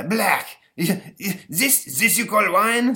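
put-on high, slow, outraged French accent; disgusted French ghost. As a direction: spit it out like an offended French emperor. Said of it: "says the ghost".

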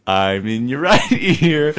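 slow, laughing through it; in your right ear. Shown as a demonstration. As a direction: laugh while speaking slowly. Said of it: "We can play with the pan".

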